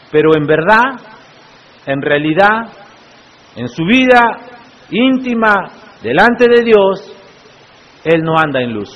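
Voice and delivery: ordinary talk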